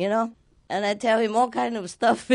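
normal talking voice

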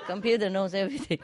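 laughing through the words, fast; amused laughing delivery. Real, not acted. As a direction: giggle out the line quickly. Laughing at own joke.

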